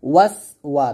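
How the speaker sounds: ওয়াস closed on s, then a separate ওয়া; no vowel between ওয়াস and ওয়া; correct pronunciation. Shown as a demonstration. Teacher gives the proper form, ওয়াস held on s before ওয়া. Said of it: "বলতে হবে".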